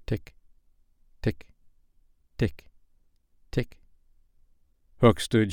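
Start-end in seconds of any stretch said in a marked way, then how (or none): 0.1-3.8 clock ticking. Acted. Crocodile clock approaching.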